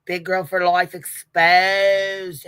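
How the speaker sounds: hammed-up posh British proclamation; mock British accent. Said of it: "do you guys love my accent there".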